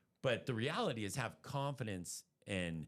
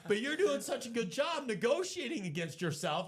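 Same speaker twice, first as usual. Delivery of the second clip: amused excited retelling; 9 semitones higher, 5 dB louder than usual. Real, not acted.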